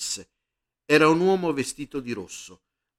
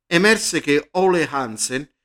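ordinary delivery

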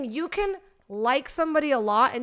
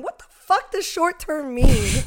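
exasperated rant; 4 semitones higher, 8 dB louder, slower than usual. Real, not acted. Ranting about Hinge.

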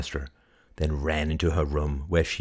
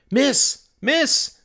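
high, loud call from a doorway; high urgent shout to a customer; 6 dB louder than usual. Acted. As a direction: call after someone leaving. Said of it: "cried Rupert from the doorway".

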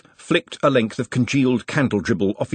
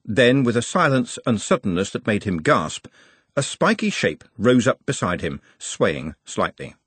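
plain reading voice

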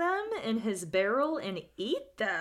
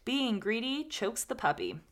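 usual speaking voice

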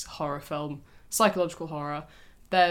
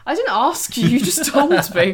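loud protest; 6 semitones higher, 10 dB louder than usual. Real, not acted. Indignant denial in playful argument.

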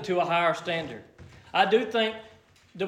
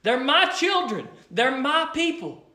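tender insistence; 7 semitones higher, slower than usual. Retold or imitated. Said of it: "he said".

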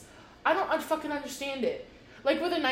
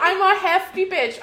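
loud, high and proud; bold loud declaration; 7 semitones higher, 7 dB louder than usual. Real, not acted. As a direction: say it again loudly, owning it. Repeats her proud claim.

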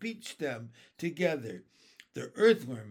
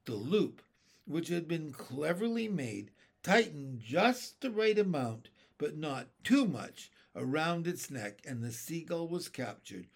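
ordinary delivery, nothing marked

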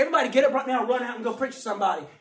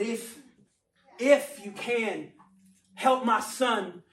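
halting plea; much slower than usual. Retold or imitated. Desperate father pleading to Jesus.

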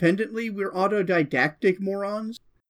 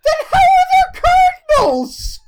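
incredulous; 8 dB louder than usual. Real, not acted. Incredulous challenge.

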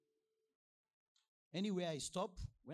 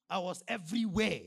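loud, insistent preaching; raised emphatic voice; emphatic claim; 5 semitones higher, 10 dB louder than usual. Real, not acted. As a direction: proclaim it loudly to the congregation. Emphatic claim, then calmer repeat.

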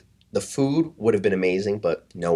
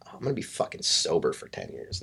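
low, softened inner grumble; hushed dismay; 6 semitones lower, 8 dB quieter than usual. Retold or imitated. Traveler dreading a sober trip, hushed dismay. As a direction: mutter it to yourself, dreading it.

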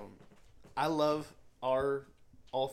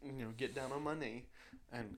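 ordinary delivery

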